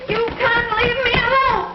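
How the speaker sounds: high, slow wail; distressed pleading. Acted. Wife begging husband not to leave her, distressed plea.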